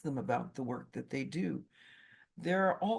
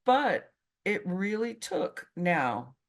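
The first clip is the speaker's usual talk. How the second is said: usual speaking voice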